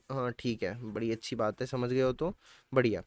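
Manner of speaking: quietly approving the class; quiet aside. Real, not acted. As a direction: drop the voice right down. Quiet aside after question.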